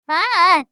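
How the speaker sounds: loud startled scream; loud mock scream of fright. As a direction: yelp as the tiger pounces. Tiger attacks.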